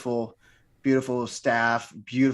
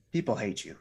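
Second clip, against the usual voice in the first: usual speaking voice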